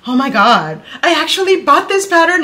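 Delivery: high, loud, rushed fan excitement; geeking out. Retold or imitated. Fan telling the designer she bought it, geeking out. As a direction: gush at speed, voice rising high. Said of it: "And I started geeking out".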